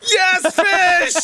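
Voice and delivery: high, drawn-out laughing cry; mock insistence. Player mocking Codex's fishing success, high laughing cry.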